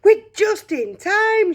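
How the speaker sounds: high, loud, slow triumphant shout; an old man bellowing with joy; old man's shout. Acted. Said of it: "shouted Grandpa Joe".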